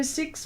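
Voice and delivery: 'six' with a New Zealand short i; New Zealand vowel. Shown as a demonstration. Host teasing how Kiwis say it, odd vowel in 'six'. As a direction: say 'six' the Kiwi way, vowel pulled toward 'sux'. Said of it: "They say six funny as well".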